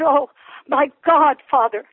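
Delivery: high, loud, urgent pleading; retold crying out. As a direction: re-enact an anguished outcry to God. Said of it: "screaming and crying out to God".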